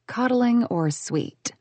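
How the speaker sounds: ordinary delivery, nothing marked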